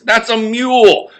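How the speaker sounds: high, slow, emphatic correction; exasperated correction. Acted. Mason correcting Duncan, high and slow exasperation. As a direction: spell it out like he's talking to a child.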